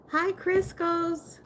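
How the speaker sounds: very high, drawn-out hello; high and stretched-out greeting; sing-song greeting. Real, not acted. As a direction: greet a viewer in a long, high coo. Repeats the greeting to viewer.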